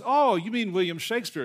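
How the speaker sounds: high, loud retold realization; dawning realization. Librarian finally understanding the student, acted surprised realization.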